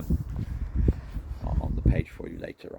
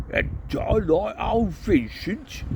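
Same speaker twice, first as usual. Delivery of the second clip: put-on slow, higher old barbarian; Cohen voiced; 11 semitones higher than usual. Cohen remembering Vincent, slow higher grumble. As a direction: lean into the gruff old hero.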